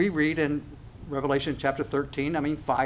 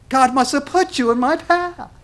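much higher, louder, thrilled voice; gushing believer; 11 semitones higher, 7 dB louder than usual. Acted. A believer gushing gratitude to the false Christ, high and loud. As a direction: gush with wide-eyed delight.

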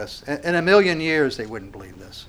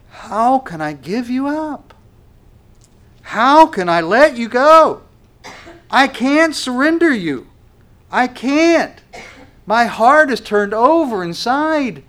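higher, louder, slow pleading cry; anguished preaching; 10 semitones higher, 8 dB louder than usual. Acted. Preacher voicing God who cannot give Israel up, anguished and slow. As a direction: plead as a heartbroken God, slowly.

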